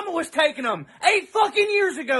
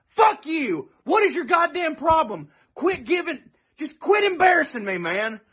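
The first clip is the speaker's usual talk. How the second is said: ordinary talk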